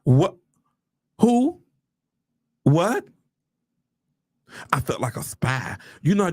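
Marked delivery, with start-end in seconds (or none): 0.0-3.0 loud, short rising questions; incredulous, punched one-word questions; stunned disbelief. Retold or imitated. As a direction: burst out in disbelief, pausing between words. Acting stunned reading the letter.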